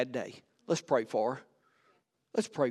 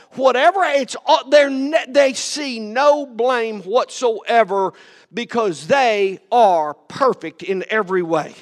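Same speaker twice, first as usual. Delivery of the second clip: high and loud, driving sermon; full-force preaching; 8 semitones higher, 10 dB louder than usual. Acted. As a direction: keep preaching it hard.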